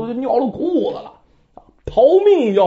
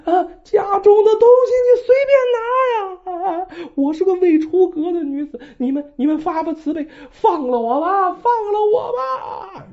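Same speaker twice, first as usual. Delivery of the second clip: high tearful begging, voice breaking; woman's crying plea; 10 semitones higher than usual. Acted. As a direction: plead for mercy through tears. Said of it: "于是这女子哭喊声". (高声哭喊着哀求)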